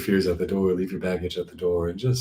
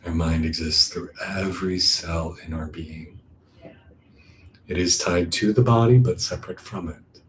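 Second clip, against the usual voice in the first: calm meditation voice with long, settling pauses; guided meditation; 2 semitones lower than usual. Acted. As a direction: guide them inward, unhurried.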